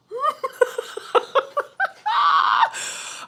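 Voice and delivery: high airy shrieking laugh; real laughing shriek. Real, not acted. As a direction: burst into a squealing laugh. Eggplant shower joke.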